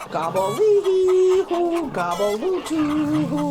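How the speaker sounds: high, slow, loud turkey gobble. Acted. Turkey practises his greeting with a loud drawn-out gobble. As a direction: let the gobble ring out. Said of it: "he let out the loudest gobble".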